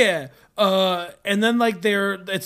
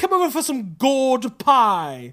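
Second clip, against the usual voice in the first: higher, hammed-up British host; British accent; 4 semitones higher, 4 dB louder than usual. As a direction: invite guests in a mock British voice. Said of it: "I'm British, can't you tell?".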